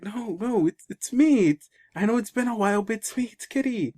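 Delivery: high-pitched mock pleading; acted pleading. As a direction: beg a crying child to remember you. Pleading with crying child, retold.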